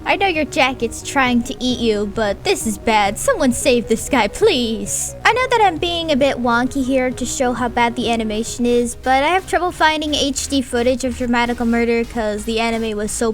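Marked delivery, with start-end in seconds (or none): none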